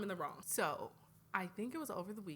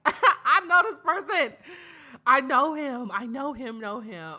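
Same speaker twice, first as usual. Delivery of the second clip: loud, laughing, high: I know him; excited laughing recognition; 5 semitones higher, 18 dB louder than usual. Retold or imitated. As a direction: shout it out laughing as if you just spotted a friend. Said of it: "I was like".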